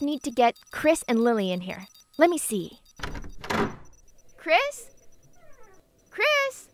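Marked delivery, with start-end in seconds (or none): 4.4-4.9 calling a name across the room. Real, not acted. Looking for Chris, calling out. 6.1-6.7 high call across the room; calling out higher. Real, not acted. Calls again, louder search.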